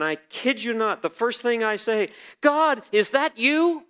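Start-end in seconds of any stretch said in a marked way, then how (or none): 2.4-3.9 higher, louder and slower disbelief; a stunned, lifted cry to God; startled question. Retold or imitated. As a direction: call out in amazed surprise. Kid you not, surprised.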